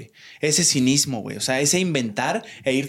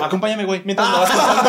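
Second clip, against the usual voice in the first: higher and louder, laughing through it; laughing mock invite; 5 semitones higher, 4 dB louder than usual. Teasing a street salesman, laughing mock invitation. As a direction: mock-friendly invite to the scammer. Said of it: "y les digo".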